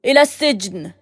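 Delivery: loud, haughty command; arrogant shout. A narrator's reading. The governor ordering guards, loud and arrogant. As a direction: bark the order with pride. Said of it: "وهتف بغرور".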